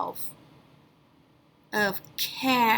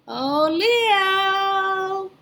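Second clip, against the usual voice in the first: excited greeting; 8 semitones higher than usual. Acted. Teacher greets the puppet.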